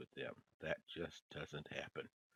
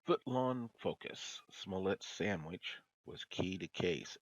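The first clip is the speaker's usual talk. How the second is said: emphatic headline read; 3 semitones higher, 9 dB louder, slower than usual. Real, not acted. Reading a mocking headline aloud.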